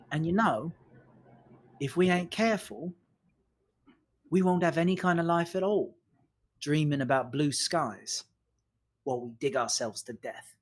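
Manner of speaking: measured, patronizing little lecture. Acted. Trilby pats a listener on the shoulder, gently patronizing. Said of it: "in kind of a patronizing way".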